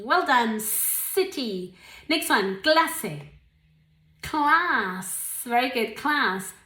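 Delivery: ordinary delivery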